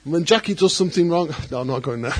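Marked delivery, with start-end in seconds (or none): 1.4-2.2 laughing aside. Real, not acted. Laughs off joke about wife.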